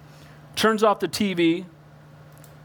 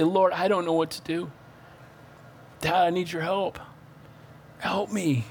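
soft, earnest pleading prayer; heartfelt prayer; 3 semitones lower, 4 dB quieter than usual. Retold or imitated. Preacher voicing a struggling believer's soft plea. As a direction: plead softly to God from the heart.

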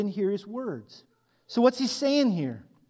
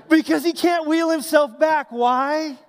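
higher and louder, gleeful; excited explaining; 6 semitones higher, 7 dB louder than usual. Real, not acted. Preacher explains the joke to the congregation, high and loud. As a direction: punch the payoff with glee.

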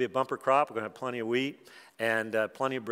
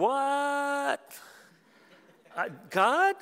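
bewildered cry to God; 13 semitones higher, much slower than usual. Retold or imitated. Joseph despairing in prison.

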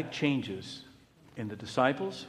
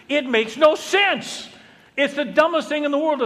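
exasperated; 11 semitones higher, 7 dB louder than usual. Real, not acted. Preacher exasperated at the claim.

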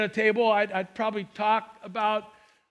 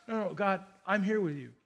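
soft surrender; 2 semitones lower, 7 dB quieter than usual. Retold or imitated. Believer answering God softly.

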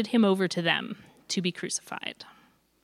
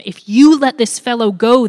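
very loud, raised crowd shout; shouted threat; 4 semitones higher, 12 dB louder than usual. A narrator's reading. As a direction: shout it at the governor. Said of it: "the Judeans shouted at him".

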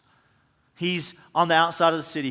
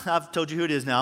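usual speaking voice

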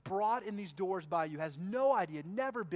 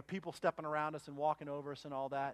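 ordinary talk

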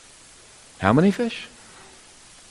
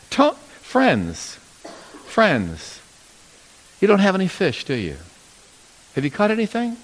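softer, caring question; gentle, solicitous; 3 semitones higher, 4 dB quieter than usual. Retold or imitated. Jesus calling softly to his tired friends, gentle. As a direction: ask kindly, as if you truly care. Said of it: "It's, it's, it's a more gentle turn".

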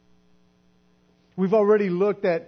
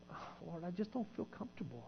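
mock pious whisper; 2 semitones lower, 20 dB quieter, faster than usual. Mock whiny pious prayer.

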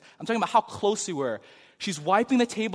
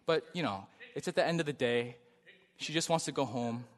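softer, lower calm reasoning; calm friend talking him down, soft and reasonable; 5 semitones lower, 7 dB quieter than usual. Retold or imitated. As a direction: soothe an angry friend gently. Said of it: "he's just really calm".

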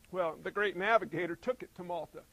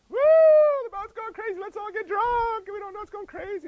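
high, wild whooping reveler; hammed-up drunken party shouting, pitched way up; rowdy party crowd; 12 semitones higher, 12 dB louder than usual. Preacher mimicking a crowd partying through the storm, hammed-up high whooping.

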